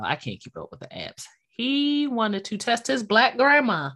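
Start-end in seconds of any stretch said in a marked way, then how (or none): 0.0-1.2 fast whispered aside; hushed throwaway aside. Real, not acted. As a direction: whisper it quickly to yourself. Aside about apps.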